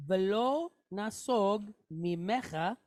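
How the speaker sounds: slow, separated "velo nasog mimecha"; pronunciation demo. Shown as a demonstration. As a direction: read out "velo nasog mimecha" word by word, vowels held. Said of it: "So we have".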